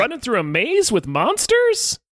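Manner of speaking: high-pitched disbelieving question; high, incredulous mock protest. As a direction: act out baffled disbelief, pitch climbing. Mock incredulous quote of a character.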